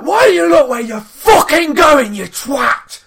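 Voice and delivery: high, full-volume road-rage shout; furious shouting, re-enacted; retold angry shout. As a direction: bellow it out of the car window. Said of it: "I shouts out the window".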